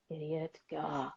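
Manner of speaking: low, soft grumble; mild muttered annoyance. Retold or imitated. Calmer driver reacts to slow car, quiet mild grumble. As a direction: mutter it under your breath and move on. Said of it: "I feel calmer".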